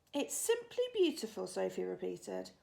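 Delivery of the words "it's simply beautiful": put-on high earnest little girl. A narrator's reading. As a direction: lift it up like a sincere child. Sophie insists.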